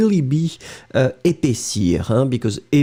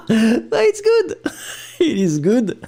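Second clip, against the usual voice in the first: laughing; 8 semitones higher, slower than usual. Real, not acted. Laughing at viewer's comment.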